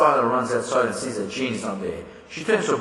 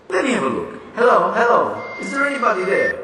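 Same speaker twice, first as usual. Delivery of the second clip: higher, louder calls down the line; loud hello into phone; 8 semitones higher, 6 dB louder than usual. Acted. Miss Tyler testing the dead line, calling loudly. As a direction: demand an answer from the silence.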